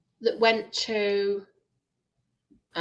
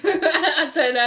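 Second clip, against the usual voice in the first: real laugh running into higher-pitched words; 6 semitones higher, 4 dB louder, slower than usual. Real, not acted. Friend asking what a joke is, laughing. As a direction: say it slowly through a laugh. Laughs at own question about jokes.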